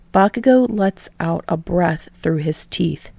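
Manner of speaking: usual speaking voice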